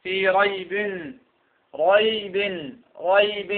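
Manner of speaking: "ريب" repeated as "raybin", the final n sounded; kasra tanween. Shown as a demonstration. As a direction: dwell on each "ريب" with its "-in" ending. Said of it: "هذا تنوين ماذا؟ الكسر".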